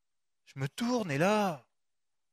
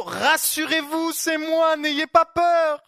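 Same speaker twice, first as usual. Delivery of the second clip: higher, louder, slow proclamation; commanding reassurance; 7 semitones higher, 5 dB louder, slower than usual. Retold or imitated. Jesus calling to frightened disciples, loud slow reassurance. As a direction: call out over the storm to calm them. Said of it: "il l'a dit un peu comme ça".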